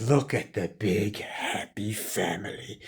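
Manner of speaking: mock the family under your breath; sneering villain. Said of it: "he's like".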